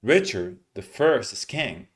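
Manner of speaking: "Richard the first is king" slow, up-down rhythm; slow stress-timed demo line; rhythmic stress. Shown as a demonstration. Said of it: "you keep the rhythm going up and down".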